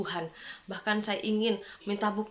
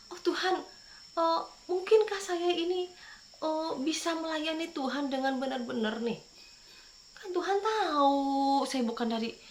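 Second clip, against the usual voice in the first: retold whining plea, a bit higher; complaining to God; 7 semitones higher than usual. Remembering how he once complained to God, mild mock lament. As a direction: imitate your old self grumbling at God. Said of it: "Saya mengeluhnya begini".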